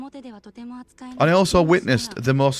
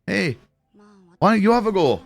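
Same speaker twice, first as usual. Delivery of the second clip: higher-pitched imitation Scottish accent; 6 semitones higher than usual. Man in a pub urging a woman to play, imitation Scottish accent. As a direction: put on a Scottish lilt, pitching it up. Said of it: "This is my Scottish accent".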